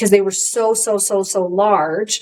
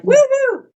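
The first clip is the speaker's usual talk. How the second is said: high-pitched re-enacted cheer; excited whoop; 4 dB louder than usual. Showing her usual visceral response, excited whoop. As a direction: let out a gleeful high woohoo. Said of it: "I'm excited".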